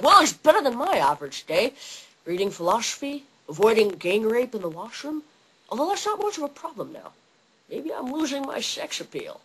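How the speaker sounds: slushy mock Scottish accent; dry quip in a lisping Scottish burr; Sean Connery. Star quipping in the Alcatraz sewers, mock Scottish accent. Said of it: "Sean Connery's like".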